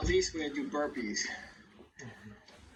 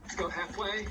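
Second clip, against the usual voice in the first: quick, higher coaching calls; aerobics instructor; 3 semitones higher, 5 dB quieter, slower than usual. Acted. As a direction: drive the class to move fast. Cueing class during ins and outs.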